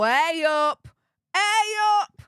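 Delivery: bright loud hey up to a viewer; calling out hey up. Real, not acted. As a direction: call it across the room cheerily. Greeting a viewer's call-out.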